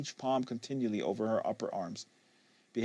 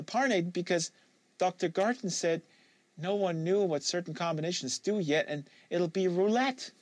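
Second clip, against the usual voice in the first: put-on a touch higher young woman's voice, guarded; Kate, lightly voiced; 8 semitones higher than usual. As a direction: give Kate a slightly higher, wary voice. Kate confessing.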